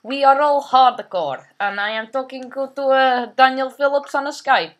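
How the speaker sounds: thick put-on Russian accent, broken English; heavy Russian accent menace. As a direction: play a comic Russian gamer with a heavy accent. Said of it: "Shut up, talking like that".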